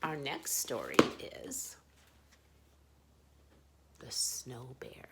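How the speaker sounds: plain reading voice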